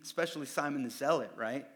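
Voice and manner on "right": normal talking voice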